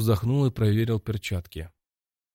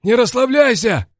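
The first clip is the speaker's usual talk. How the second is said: much higher, loud warning yell; shouting; 13 semitones higher, 10 dB louder than usual. A narrator's reading. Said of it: "закричал Орлам".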